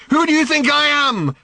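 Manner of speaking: high, loud furious challenge; angry demand. Acted. Daughter demanding angrily of her father. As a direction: spit the question at him. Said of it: "she demanded angrily".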